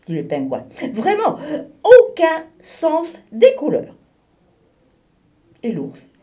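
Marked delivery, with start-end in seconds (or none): 0.9-4.0 higher, louder, giggling mockery; laughing agreement. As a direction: laugh along at the walrus. Penguin agrees mocking walrus.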